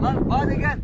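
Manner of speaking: high, urgent yell; yelling for the dog. Real, not acted. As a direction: call the dog back sharply.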